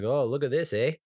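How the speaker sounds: light mock Canadian accent, amused. Canadians watching the American chaos, in a light Canadian accent. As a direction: play a smug Canadian watching across the border, lightly. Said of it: "sitting across the border like".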